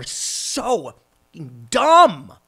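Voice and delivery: scornful yelled dumb; emphatic dumb. Real, not acted. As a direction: blast the word dumb in disbelief. Ranting about people being dumb.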